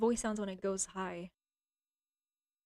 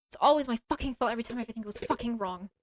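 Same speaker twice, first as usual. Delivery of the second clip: ordinary talk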